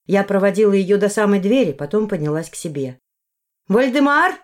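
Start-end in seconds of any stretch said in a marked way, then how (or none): 3.7-4.3 raised, high call into the house; loud rising summons. A narrator's reading. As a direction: call the name from the doorway. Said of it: "крикнула я с порога".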